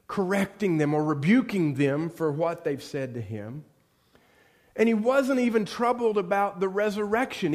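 usual speaking voice

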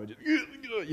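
high, squeezed imitation of crying mad; brief hammed-up frustrated blubber; mock angry crying. Preacher recalls crying when mad growing up, mock angry crying. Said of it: "I'd just cry all the time".

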